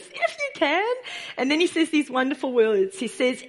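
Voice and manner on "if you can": incredulous echo, pitched much higher. Retold or imitated. Jesus echoing the father's doubt, incredulous. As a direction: repeat it in disbelief, rising high. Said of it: "it's so incredulous".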